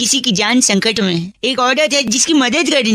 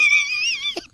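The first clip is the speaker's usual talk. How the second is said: high burst of laughter; 7 dB quieter than usual. Real, not acted. Prankster laughs.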